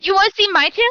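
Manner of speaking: teasing high-pitched offer; playful high voice. Acted. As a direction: tease them, voice lifted. Player flirty character line.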